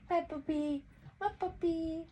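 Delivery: high, soft cooing over her puppy; baby talk to puppy. Acted. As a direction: coo it like talking to a baby dog. Doting on her dog.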